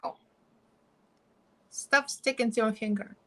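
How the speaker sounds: ordinary talk, nothing marked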